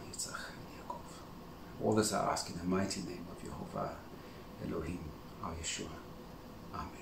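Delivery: soft, reverent praying voice, low and quiet; a humble whispered plea to the Master; hushed prayer. Real, not acted. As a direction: pray quietly, as if alone with God. Leads the opening prayer.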